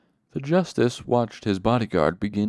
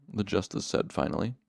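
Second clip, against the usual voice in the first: ordinary delivery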